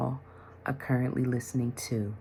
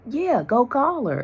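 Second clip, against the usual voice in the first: eager urging; 12 semitones higher, 7 dB louder, slower than usual. Retold or imitated. Husband urging her to call.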